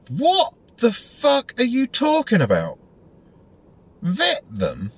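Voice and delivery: high, slow baffled what-the-fuck; disbelieving outburst. Retold or imitated. Reacting to his mate's vetting idea, high and baffled. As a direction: drag it out in disbelief.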